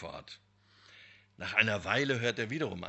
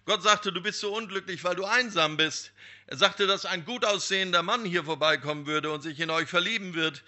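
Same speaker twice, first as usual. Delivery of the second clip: higher, louder, fast, breathless haste; calling while running; 6 semitones higher, 6 dB louder than usual. Acted. Young man calls back to the woman while running off, loud and hurried. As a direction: shout it back while hurrying on. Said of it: "rief er im Laufen zurück".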